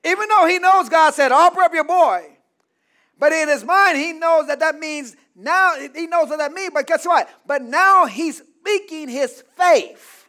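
loud, high, climactic preaching; full-force preaching. Acted. Preacher telling Abram's faith to the church, loud climax.

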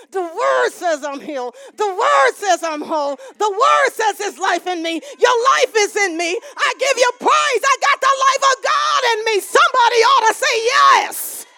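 building declaration to a high, loud shout; preaching at full force. Real, not acted. Believer declaring the word says I'm healed, rousing preaching. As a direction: drive each phrase harder, climbing to a shout.

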